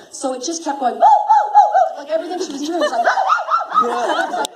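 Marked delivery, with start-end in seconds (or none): 0.9-1.9 very high, loud string of woos; piercing cartoon whoops; imitated poodle yelps. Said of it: "I sound like a poodle that's being beaten". 3.8-4.4 very high, loud string of woos; piercing cartoon whoops; imitated poodle yelps. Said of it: "I sound like a poodle that's being beaten".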